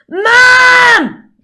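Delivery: very loud, high "mam" yell; child's shout for mum. Acted. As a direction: yell for Mum like a Southwest kid. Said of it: "take on their voice".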